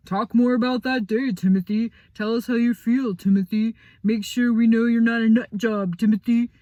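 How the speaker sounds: loud, high singsong mimic of a therapist; mocking counselor. Boy mocking the counselor's questions, loud singsong. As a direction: parrot the grown-ups in a nagging sing-song. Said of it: "For weeks it has been".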